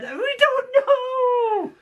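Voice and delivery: very high, drawn-out kiddie wail; terrified children. Retold or imitated. Five terrified munchkins answer the teacher, high frightened wail. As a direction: squeal it slowly as scared little munchkins. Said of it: "they just remained terrified all the time".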